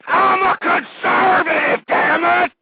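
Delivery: "I'm a conservative" screamed high, loud and slow; screaming. Real, not acted. Radio host screams his creed at listeners, slow full shout. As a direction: bellow it, breaking with passion. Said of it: "I don't mean to be screaming out my head".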